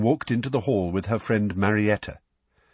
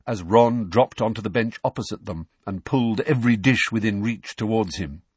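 usual speaking voice

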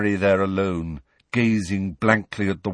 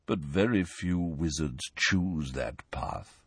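softer, slow sorrowful voice; miserable; 5 semitones lower, 7 dB quieter, slower than usual. Acted. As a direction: let it sink in sadly. Nick miserable.

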